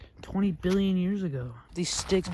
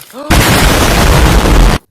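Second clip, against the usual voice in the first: huge breathy gasp; loud dramatic gasp; 6 semitones higher, 18 dB louder than usual. Pretend. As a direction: gasp as if terrified. Pretend explosion fear.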